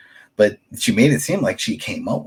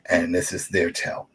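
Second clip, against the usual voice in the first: usual speaking voice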